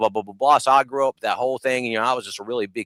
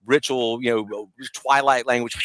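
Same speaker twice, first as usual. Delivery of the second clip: ordinary talk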